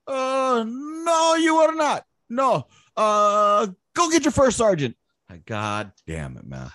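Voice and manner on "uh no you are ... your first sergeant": put-on high, emphatic Vietnamese-accented refusal. Sergeant major refusing Lopez again, Vietnamese accent.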